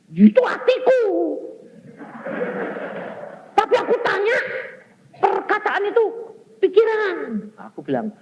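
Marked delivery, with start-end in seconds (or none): 0.1-7.2 high, loud scolding father; angry father. Retold or imitated. Father glaring and scolding his son, high and loud. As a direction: snap at the son in disbelief. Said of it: "Langsung melotot".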